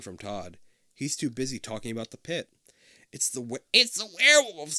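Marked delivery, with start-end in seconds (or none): none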